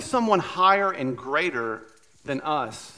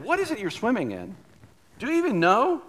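higher, pressing question that climbs; searching challenge; 8 semitones higher than usual. Pretend. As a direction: push the question up, urging him to see. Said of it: "And he's saying".

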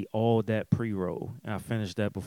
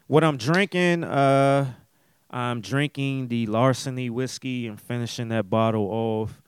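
usual speaking voice

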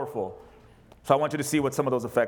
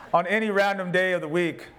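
normal talking voice

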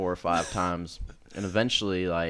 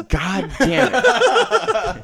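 loud, high, drawn-out exasperated curse; acted-out clerk losing it, stretching every word; pissed off; 10 dB louder, much slower than usual. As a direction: explode in mock fury, slowly. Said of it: "this guy got really pissed".

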